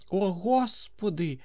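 put-on high, slow mumble of an old man; lightly mumbled old man's sigh, drawn out; toothless old man mumbling. A narrator's reading. Old man greets the sun, slow high mumble. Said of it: "зашамкотів мешканець дерев'яної хатини".

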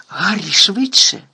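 loud, slow urgent call to hurry; calling a friend to hurry, urgent and loud. A narrator's reading. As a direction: urge him on, pressing and loud. Hermione urging Harry to hurry.

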